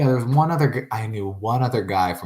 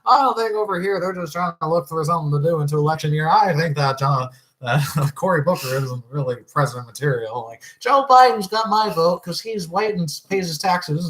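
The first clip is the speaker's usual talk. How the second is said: higher, louder mock partisan voice; mock political ranter; 8 semitones higher, 4 dB louder than usual. Podcaster parodying people's clashing political opinions, mock ranting. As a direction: imitate opinionated voters sounding off.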